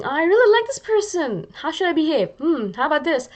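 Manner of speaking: high, eager, mock-excited thoughts; lightly mock-giddy "how about this"; giddy inner voice. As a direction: play the giddy crush in your head.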